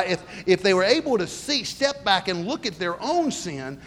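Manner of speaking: normal talking voice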